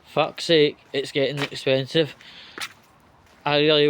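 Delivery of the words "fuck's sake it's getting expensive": ordinary talk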